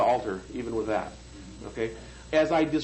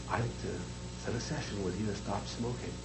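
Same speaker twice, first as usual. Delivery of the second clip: soft, low voice of a polite caller; quietly imitating the phone caller; caller imitation; 5 semitones lower, 10 dB quieter than usual. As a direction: mimic the caller softly and lower. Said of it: "he sounded like this".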